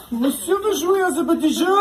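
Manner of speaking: put-on high-pitched German-accented taunt; hammed-up German interrogator; mock German accent. Torturer taunting a stubborn prisoner, high mock German accent.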